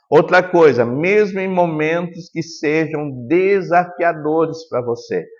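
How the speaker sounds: ordinary talk, nothing marked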